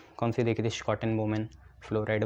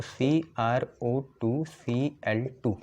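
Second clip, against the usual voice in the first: slow, symbol-by-symbol formula; measured classroom spelling of a formula; formula dictation; much slower than usual. Shown as a demonstration. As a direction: dictate each letter and number separately.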